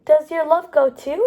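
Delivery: ordinary delivery, nothing marked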